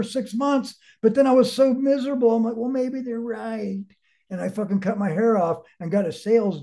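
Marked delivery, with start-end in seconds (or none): 2.6-3.8 slow, soft, defeated; resigned. Retold or imitated. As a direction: give in with a quiet sigh. Retold resigned thought.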